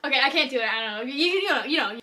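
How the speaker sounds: cracking up mid-sentence; losing it to laughter; laughing. Real, not acted. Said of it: "I seriously lost it".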